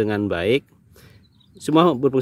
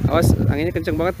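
fast, raised voice over gusts; shouting over wind; 4 semitones higher, faster than usual. Real, not acted. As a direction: call out urgently over wind. Strong wind hitting the drone.

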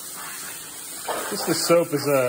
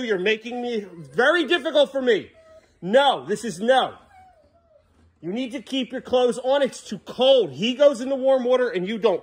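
higher-pitched, exasperated scolding; frustrated parent; 8 semitones higher than usual. Real, not acted. Parent scolding son over his clothes, frustrated. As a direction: scold him, out of patience. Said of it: "I'm getting frustrated with you".

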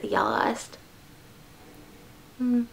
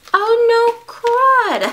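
excited cry; 12 semitones higher, 6 dB louder, much slower than usual. Real, not acted. Excited unboxing, cry heard.

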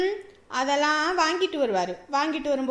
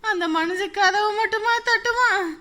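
panicked wail; 5 semitones higher than usual. Acted. Daughter-in-law dreading the man's return, panicked wailing. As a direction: lament it like disaster is coming.

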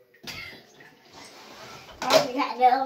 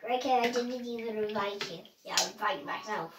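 ordinary delivery

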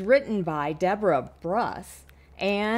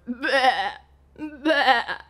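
bleating goat; 5 semitones higher, 5 dB louder than usual. Acted. Goat sound in story.

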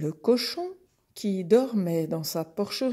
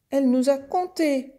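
high, loud calf tattling; complaining calf; 7 semitones higher, 6 dB louder than usual. Acted. Calf telling the horse about the goat, high indignant voice. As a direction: pipe up indignantly as a little calf.

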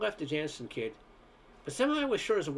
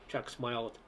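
ordinary delivery, nothing marked

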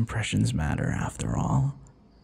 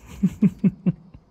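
amused laugh; 10 semitones higher, 4 dB louder than usual. Acted. Vampire teasing the hunter.

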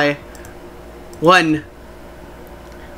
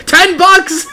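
laughing shout; 10 semitones higher than usual. Real, not acted. Mock bargaining over super chat.

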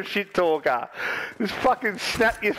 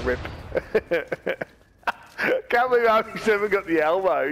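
ordinary talk, nothing marked

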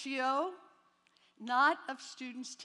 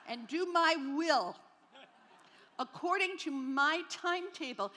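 mock self-centred prayer; 4 dB louder than usual. Young woman bossing God in her prayer, mock-solemn. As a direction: pray piously while demanding your own way. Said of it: "my prayer was like this".

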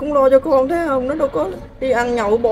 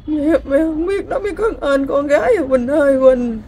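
crying plea. Real, not acted. Mother begging her runaway daughter Quỳnh to come home, crying. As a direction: voice breaking into tears as she calls her daughter.

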